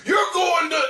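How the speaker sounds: high, loud cut-off condemnation; mock preacher shout. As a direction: start damning them like a preacher.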